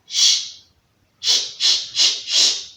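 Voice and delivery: unvoiced shh to soothe the baby; repeated drawn-out shushing; long shushing. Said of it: "Mommy was trying to make the baby sleep".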